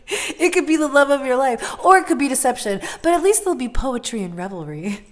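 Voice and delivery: loud, high, excited outburst; almost yelling with passion. Real, not acted. Tarot reader yelling the reading at viewers, loud and excited. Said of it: "I'm sorry, I'm yelling".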